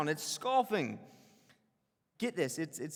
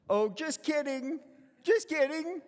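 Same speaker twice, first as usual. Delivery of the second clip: backpedalling; 9 semitones higher, 4 dB louder than usual. Retold or imitated. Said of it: "he says".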